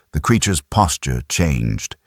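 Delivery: plain reading voice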